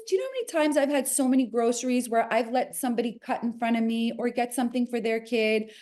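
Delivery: normal talking voice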